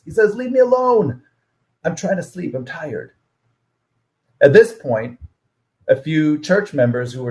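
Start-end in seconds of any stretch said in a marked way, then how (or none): 0.4-3.1 much higher, whiny leave-me-alone voice; grumpy sleepy brother. Retold or imitated. Brother dozing in church pushes him away, whiny and higher. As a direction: brush him off like a cranky teen half asleep.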